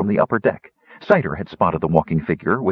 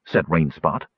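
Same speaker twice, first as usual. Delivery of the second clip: ordinary delivery, nothing marked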